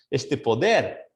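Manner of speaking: normal talking voice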